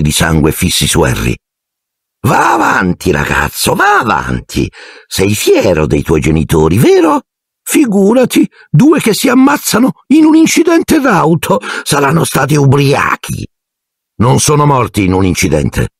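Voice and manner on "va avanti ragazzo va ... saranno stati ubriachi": aunt Marge voice. A narrator's reading. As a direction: look down your nose at the boy's family. Aunt voiced in read-aloud story.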